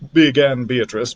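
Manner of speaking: ordinary delivery, nothing marked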